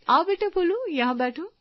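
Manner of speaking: sing-song welcome for little Bholu; sweet welcome to a child. Acted. (बच्चे को बुलाती ऊँची मीठी आवाज़)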